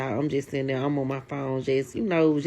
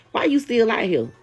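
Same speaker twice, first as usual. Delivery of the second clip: mock confrontation; 8 semitones higher, 5 dB louder than usual. Confronting boyfriend at door.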